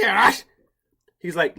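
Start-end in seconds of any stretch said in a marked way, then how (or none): 0.0-0.4 loud sharp yelp of alarm; a startled cry as the dog snaps; high loud yell; sudden yelp. Retold or imitated. Dog's startled bark-yell.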